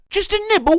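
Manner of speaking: high, wheedling offer; squeaky tempting plea; mock high coaxing. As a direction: coax it up high like a teasing friend. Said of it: "To which his reply was".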